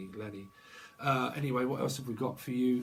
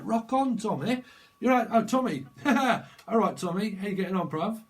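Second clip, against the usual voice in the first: higher, louder northern comic catchphrase voice; imitating a TV comedy duo; 8 semitones higher, 8 dB louder than usual. Tarot reader doing the old British TV comedy duo, loud northern cheer. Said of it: "Rock on Tommy used to be on British T V".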